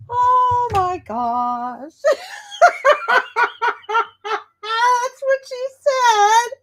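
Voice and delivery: words squeezed out high through helpless laughter; cracking up, barely able to talk; laughing hard. Real, not acted. Laughing fit at clip.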